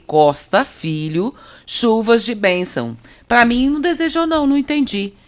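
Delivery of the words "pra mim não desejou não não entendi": mock offended. Jokes she got no greeting.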